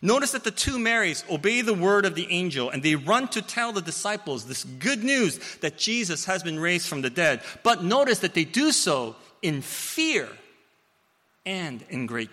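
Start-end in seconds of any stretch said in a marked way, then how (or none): none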